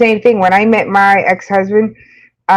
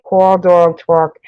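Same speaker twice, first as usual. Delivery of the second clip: rounded New York aw in each word; New York accent; 3 semitones lower, slower than usual. Shown as a demonstration. Streamer showing where her accent comes out, New York accent. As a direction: list them with New York vowels. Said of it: "you hear my New York accent".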